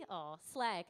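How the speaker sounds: ordinary talk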